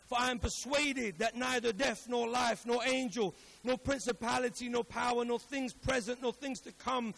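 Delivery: emphatic preacher's Bible reading; declaimed scripture. Acted. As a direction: build the list phrase by phrase, projected.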